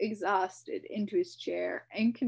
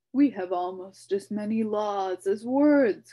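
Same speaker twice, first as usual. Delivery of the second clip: grumbling; 2 semitones higher, 11 dB louder than usual. A narrator's reading. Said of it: "grumbled the king".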